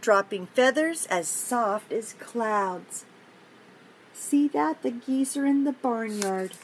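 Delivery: usual speaking voice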